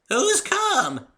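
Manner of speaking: high, loud, put-on old granny; old woman's creaky voice. Granny asks.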